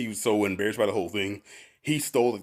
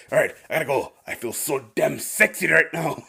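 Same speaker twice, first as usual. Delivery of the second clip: smug boss; 4 semitones higher than usual. Retold or imitated. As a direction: savour every word like a sleazy boss. Boss leaving office.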